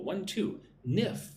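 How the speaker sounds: count, then punch nif; stress on second syllable. Shown as a demonstration. Teacher showing where the stress falls, high on nif. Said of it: "the emphasis is on the second syllable".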